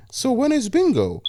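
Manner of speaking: put-on high, whiny old woman asking; high old-lady whine; frowning old lady. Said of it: "frowned and said".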